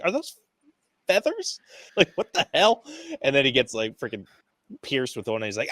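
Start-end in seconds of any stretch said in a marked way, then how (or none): 0.0-2.8 high-pitched exasperated disbelief; irritated. Retold or imitated. Film fan acting out a hero pelted with feather darts, irritated. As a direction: imitate him getting fed up, pitch jumping up. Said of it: "getting irritated with it".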